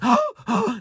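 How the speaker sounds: high, loud imitated hyperventilating gasp; mimicking a panicked gasp for breath; sharp panicked gasp. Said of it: "He saw my mother like this".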